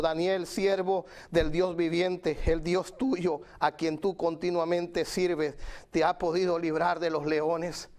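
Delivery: ordinary talk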